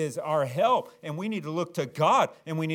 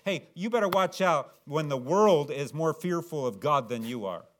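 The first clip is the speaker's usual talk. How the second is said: ordinary talk, nothing marked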